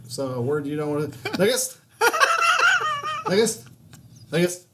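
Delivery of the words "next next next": repeated high, louder Next with laughter; imitating the server again and again; mocking the counter guy. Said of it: "he says it really fast".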